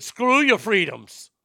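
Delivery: put-on loud, slow Austrian accent, Schwarzenegger style; slow, loud Austrian accent with a fall on your. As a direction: bark it out in a big Austrian accent. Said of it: "in a big Austrian accent".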